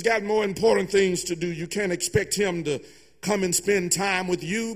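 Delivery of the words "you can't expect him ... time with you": ordinary delivery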